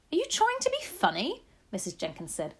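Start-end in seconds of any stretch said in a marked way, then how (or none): 0.1-1.4 high, prim wife. A narrator's reading. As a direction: ask it primly, high-pitched. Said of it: "very prim".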